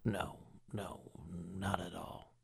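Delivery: ordinary delivery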